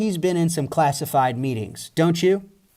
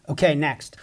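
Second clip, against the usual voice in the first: ordinary delivery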